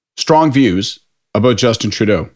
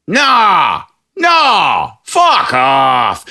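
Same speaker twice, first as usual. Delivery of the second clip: dismissive pub guy; 6 semitones higher, much slower than usual. Retold or imitated. Pub guy brushes off the defence, mock angry shout. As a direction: shout the no, then wave him off.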